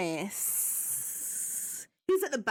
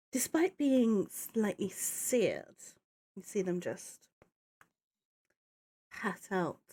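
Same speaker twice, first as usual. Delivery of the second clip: game master narration; 4 semitones lower than usual. Acted. DM narrating the lich's turn.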